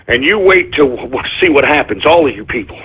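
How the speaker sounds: ordinary talk, nothing marked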